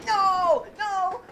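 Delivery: high yell, easing off on the repeat; dismayed shout. Real, not acted. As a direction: cry out against the loss. Dismay at losing loot.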